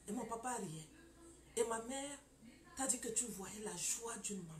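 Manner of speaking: ordinary delivery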